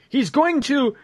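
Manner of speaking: hammed-up high comic panic; mock alarmed character. Reading the other guy's line, high mock alarm. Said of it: "The other guy goes".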